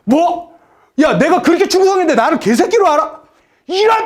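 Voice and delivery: an insulted general shouting in rage; furious general. Acted. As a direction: blow up at the insult. Cheok Jun-gyeong explodes in anger.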